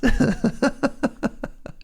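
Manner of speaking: high, rolling giggle fit; long laugh. Real, not acted. Storyteller cracking up at own impression, long giggle. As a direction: collapse into helpless giggles.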